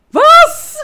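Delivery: high squealed disbelief, laughing; a sudden, very high, loud cry of disbelief; mock outrage, breaking into laughter; shocked what. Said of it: "Und ich so".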